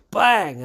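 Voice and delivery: loud high shouted bang; a sudden shouted bang; mock bang. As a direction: blurt the bang out like a jump scare.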